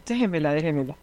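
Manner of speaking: quiet, gentle plea; soft coaxing. Retold or imitated. Teacher softly asks the mother to hand over the girl.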